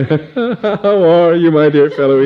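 high, slow, nervous toff voice; nervous baronet. Acted. Nervous baronet greeting Adrian with a little laugh. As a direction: fawn over him with a nervous laugh. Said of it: "with a nervous little laugh".

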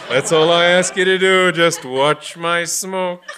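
slow laughing brag, stressing just; laughing boast. Acted. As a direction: chuckle through the boast.

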